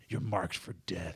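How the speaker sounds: low, soft Jamaican gangster threat; movie villain threat. Acted. Comic explaining the film's title, low villain voice. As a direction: deliver a lightly voiced, low death threat. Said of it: "the Jamaican symbol".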